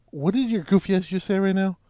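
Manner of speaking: high-pitched incredulous challenge; retold fed-up comeback; out of patience. Storyteller challenging the helper's excuse, incredulous and impatient. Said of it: "I have no patience left with him".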